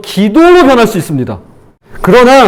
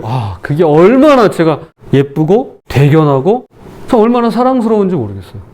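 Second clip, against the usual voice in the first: ordinary talk, nothing marked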